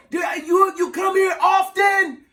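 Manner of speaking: slow, high mock holler; yelling over music. Man asking her again in club, slow yell. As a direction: shout it slowly so she hears. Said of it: "You gotta yell when you're in a club".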